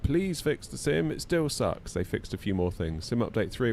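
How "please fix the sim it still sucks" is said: slow, higher whiny plea; hammed-up slow begging; mock pleading. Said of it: "we're like".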